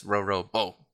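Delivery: "Roro Bo" with the weight on Bo; stress on BO. Shown as a demonstration. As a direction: lean on the final "Bo". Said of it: "you must put the emphasis on the end".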